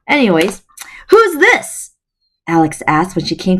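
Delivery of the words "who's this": put-on much higher, a curious young girl; young girl asking who this is, pitched up. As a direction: lift into a bright girl's voice asking. Alex voiced, curious girl.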